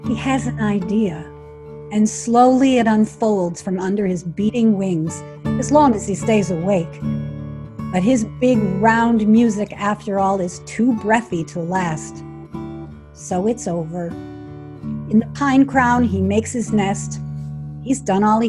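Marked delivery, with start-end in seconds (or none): none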